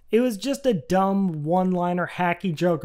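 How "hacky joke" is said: ordinary talk, nothing marked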